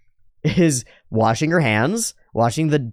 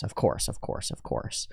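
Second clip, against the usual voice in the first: softer and softer, into a whisper; smug words fading to a whisper; 4 semitones lower, 11 dB quieter than usual. Retold or imitated. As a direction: let the self-assurance trail off unvoiced. Mage's smug muttering fading.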